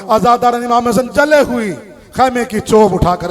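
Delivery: ordinary talk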